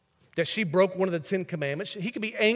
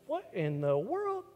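astonished; 8 dB quieter, slower than usual. Retold or imitated. Shock at sparking closet.

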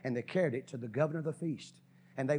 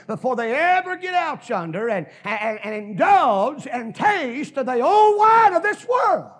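rising into a full shout, much higher and louder; the preacher's voice climbs to a roaring peak; preaching shout; 10 semitones higher, 16 dB louder than usual. Real, not acted. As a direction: cry it out from the pulpit, building. Preaching climax.

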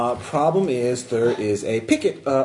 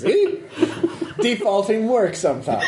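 relieved laughter; 9 semitones higher than usual. Real, not acted. Laughing at the lucky roll.

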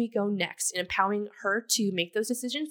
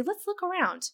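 encouraging mum; 11 semitones higher than usual. Retold or imitated. Mother coaching scared climbing toddler.

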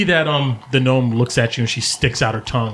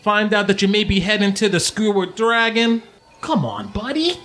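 high-pitched friend reporting gossip; Sly, teasing; 8 semitones higher than usual. Acted. Sly showing Domino the broadsheet, teasing. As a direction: read it out high, needling him.

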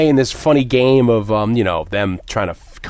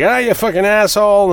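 higher, louder hammed-up insult; mock angry rival; 9 semitones higher, 4 dB louder than usual. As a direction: snarl it up high like a sore bully. Rival Pony insults Darby, voiced.

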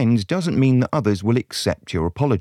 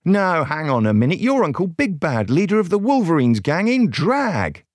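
high, accusing outburst; indignant realisation; 7 semitones higher than usual. A narrator's reading. Soberina unmasking Uncle Big Bad, indignant realisation. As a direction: call out the impostor in disbelief.

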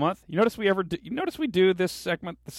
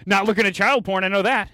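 higher and louder, a guilty man blurting a denial; mock defensive blurt; 6 semitones higher, 7 dB louder than usual. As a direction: blurt it out too fast to sound innocent. Acted guilty blurted reply.

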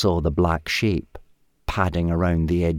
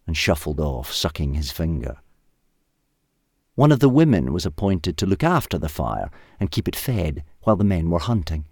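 usual speaking voice

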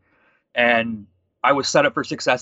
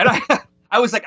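laughing; 10 semitones higher, 5 dB louder, faster than usual. Real, not acted. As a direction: laugh through "I was like", pitched up and quick. Retelling awkward boss exchange.

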